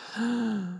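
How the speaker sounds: sharp offended gasp; mock gasp. Mocking the Chipotle server's stingy scoop with a shocked gasp. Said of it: "and I'm like".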